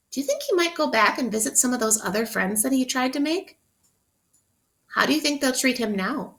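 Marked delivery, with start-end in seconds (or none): none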